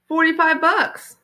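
smug boast. Acted. Paul boasting about profit.

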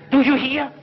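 threatening shout. Acted. Zaldu warns his servant, rising to a shout.

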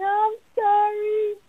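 high, slow, tearful "I'm sorry"; whimpering apology. Acted. Child standing before a sibling, whimpering sorry. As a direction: mumble it through tears, head down. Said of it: "just standing there saying".

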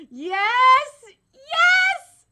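loud, rising shouts of joy; elated cheering. Real, not acted. Fan reacting to the long-awaited turn, elated shouting. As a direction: cheer it at full volume.

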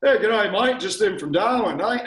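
hammed-up Australian hello; pretend Australian. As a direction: put on a hearty Australian accent. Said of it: "I pretend to be Australian".

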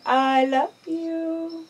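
"I love you" drawn out very slowly, high, leaning on "love"; slow drawn-out model. Shown as a demonstration. Owner teaching her pet bird the phrase, slow sing-song drawl. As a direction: stretch every word so the bird can copy it. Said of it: "Tell me".

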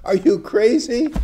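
mock incredulous, high; as if the idea is absurd; high playful disbelief. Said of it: "I'm putting my words".

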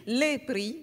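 "les prix" slowly, each word set apart; pronunciation demo. Shown as a demonstration. Teacher showing students the plural, deliberate "les prix". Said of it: "avec mon accent".

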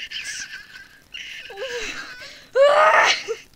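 loud, high excited shriek; celebrating scream. Real, not acted. Player who just made it, loud celebrating scream. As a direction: let out a high scream of triumph. Celebrating after literally making it.

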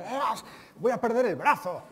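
high, loud melodramatic wail; whiny man exaggerating pain. Lecturer mocks men whining over small pains, loud hammed-up wail. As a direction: ham up a man's pain like a tragedy. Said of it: "A los hombres nos duelen más las cosas".

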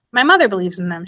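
usual speaking voice

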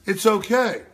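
ordinary talk

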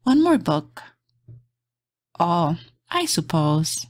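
gentle bedtime storybook read; reading to children. Acted. Reading a bedtime picture book aloud to kids, soft and gentle. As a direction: lull little listeners toward sleep.

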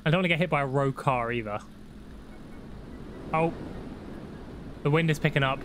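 normal talking voice

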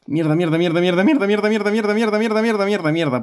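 rising frantic repeated swearing; panicked cursing. Real, not acted. Panicking while spiders attack.

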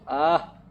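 sudden loud cry of fright; startled yell. Real, not acted. Gamer startled by a mannequin, loud yelp.

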